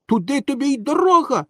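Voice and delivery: high-pitched call across the water; calling from afar. A narrator's reading. Boy answers the drowning dog from afar, high unsympathetic call. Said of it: "відповів здаля Пінокіо".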